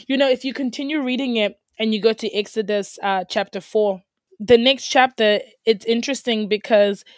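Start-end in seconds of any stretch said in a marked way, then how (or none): none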